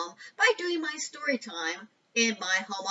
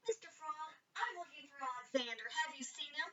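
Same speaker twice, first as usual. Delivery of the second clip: softer, asking the frog gently; 3 semitones higher, 9 dB quieter than usual. A narrator's reading. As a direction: ask quietly, a little shy. Jessie asks the frog.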